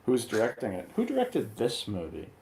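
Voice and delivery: usual speaking voice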